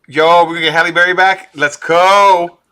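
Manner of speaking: loud, high excited shout; hyped-up fan. Acted. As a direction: holler it like a thrilled fan. Acts out hyped fan reaction.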